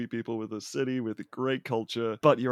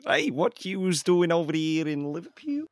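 higher, hammed-up bad Scouse accent; terrible Liverpool accent; 7 semitones higher than usual. Mock local challenging Shrek in Liverpool, terrible Scouse accent. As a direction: put on a badly faked Liverpool accent. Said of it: "sounded like Mike Tyson with a terrible British accent".

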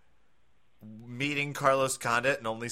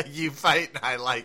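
louder, laughing echo of the tease; 4 semitones higher, 4 dB louder than usual. Retold or imitated. [name] repeating his own fumbled line, laughing. Laughing at the mocking impression of himself.

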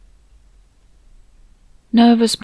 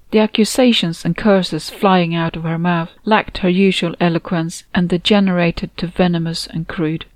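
ordinary delivery, nothing marked

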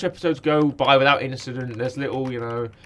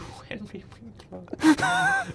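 high breathy whispered swoon, much higher; gushing fan squeal; 13 semitones higher, 5 dB quieter, much slower than usual. Pretend. Host swooning over Henry Winkler's name, high whispered squeal.

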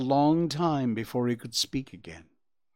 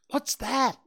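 much higher, bursting with wonder; a fawn seeing the meadow; enchanted exclamation; 5 dB louder than usual. A narrator's reading. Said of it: "he exclaimed".

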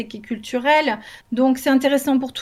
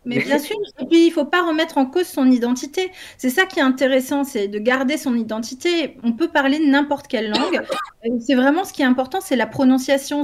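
ordinary talk

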